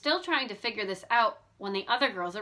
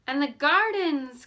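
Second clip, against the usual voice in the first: slow, louder, higher wonder; delighted cry; 5 semitones higher, 6 dB louder, slower than usual. A narrator's reading. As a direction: gasp over the lovely gardens. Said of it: "cried Bess".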